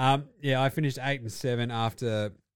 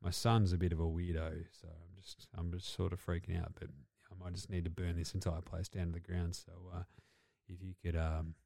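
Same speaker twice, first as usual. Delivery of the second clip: low, quiet mumbling coach; a coach quietly handing the play over, low and mumbled; Belichick impression; 6 semitones lower, 6 dB quieter than usual. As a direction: mumble it low and deadpan like a weary old coach. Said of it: "Belichick just going".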